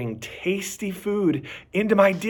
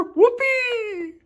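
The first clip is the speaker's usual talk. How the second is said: excited whoopee. A narrator's reading. Dog thrilled about car ride.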